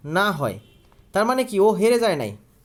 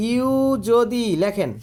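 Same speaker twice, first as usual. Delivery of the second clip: slow, spaced dictation pace; much slower than usual. Shown as a demonstration. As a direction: read it out slowly so they can write along. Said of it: "আমার মুখের কথা শুনে লিখে ফেলেন".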